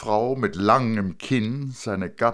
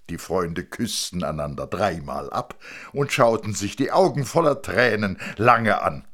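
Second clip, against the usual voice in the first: usual speaking voice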